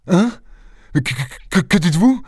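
stuttering, pitch shooting up at the end; horrified stammer. A narrator's reading. Doctor hearing of a planned murder, terrified stammering. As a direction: stumble over the first word in alarm. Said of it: "balbutia le docteur épouvanté".

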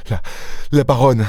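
qui bégaie, la voix plus haute; bégaiement. Acted. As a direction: buter sur « La » avant de dire « la baronne ». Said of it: "bégaya-t-il". (bégaiement horrifié)

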